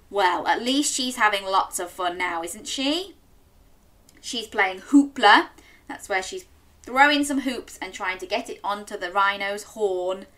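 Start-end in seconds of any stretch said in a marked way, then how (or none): none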